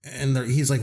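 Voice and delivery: normal talking voice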